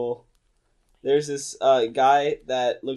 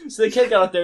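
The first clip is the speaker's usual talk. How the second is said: ordinary delivery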